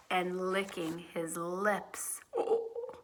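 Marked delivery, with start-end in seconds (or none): 2.4-3.0 a gasp, then an unvoiced, frightened hush; awed whisper. A narrator's reading. Narrator telling of the lion on the piano, in a frightened hush. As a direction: let the fear drop to a breath.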